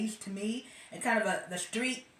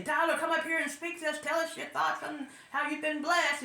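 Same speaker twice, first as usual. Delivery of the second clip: mock church host; 7 semitones higher than usual. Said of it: "they use him to come to the church and say".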